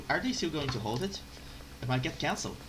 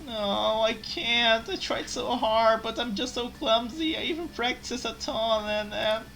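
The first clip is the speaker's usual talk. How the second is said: high tearful pleading; fake crying; 6 semitones higher, slower than usual. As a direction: pretend to sob. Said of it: "make this poor slave cry".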